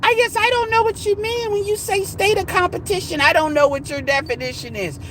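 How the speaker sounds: high mocking imitation of a woman in the thread; mocking her. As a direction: parody her as clueless and whiny. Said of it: "now she wanted to say".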